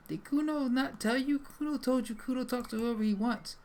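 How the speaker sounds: high, loud indignant boasting; Kuno. Acted. Kuno retorts that he talks to whoever he wants, high defiant voice.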